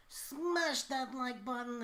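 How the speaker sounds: high, slow drawn-out hype call; YouTuber voice. Retold or imitated. Mocking YouTubers begging for likes, slow hype. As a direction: stretch out the hype plug. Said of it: "everyone's like".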